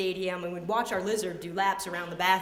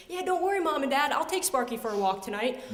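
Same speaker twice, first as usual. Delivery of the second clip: higher-pitched mock-cheerful kid; mock-helpful; 7 semitones higher than usual. Child needling parents about walking a lizard, mock-helpful. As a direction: rub it in with a bright offer.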